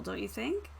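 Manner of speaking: ordinary delivery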